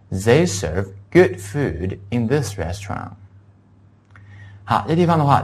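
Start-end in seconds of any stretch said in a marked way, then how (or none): 0.1-3.1 slow, spaced-out teacher's reading; careful classroom model sentence; read-along model. Shown as a demonstration. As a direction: say it phrase by phrase for students to follow. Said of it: "大家一起跟我读一下".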